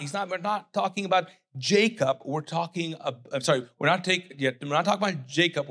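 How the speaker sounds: ordinary delivery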